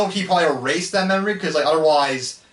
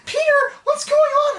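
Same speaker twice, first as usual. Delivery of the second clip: very high, loud, drawn-out whine; exaggerated shrieky whining impression; whiny cartoon voice; 5 dB louder than usual. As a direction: squeal it in a mocking whine. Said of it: "They both have such whiny voices".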